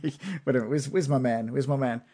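normal talking voice

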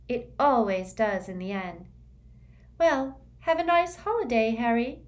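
airy, high and dreamy schoolgirl; dreamy girl voice. A narrator's reading. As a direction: float the words, lightly otherworldly.

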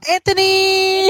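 high, loud "Anthony!"; shouted name. Pretend. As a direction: call out "Anthony!" high and bright. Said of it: "all caps".

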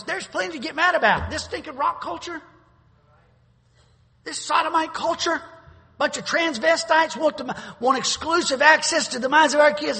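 high, loud preaching tirade; fired-up, forceful denunciation; righteous angry rant. Real, not acted. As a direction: thunder indignantly from the pulpit. Fired-up rant against the culture.

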